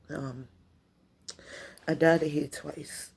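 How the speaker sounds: usual speaking voice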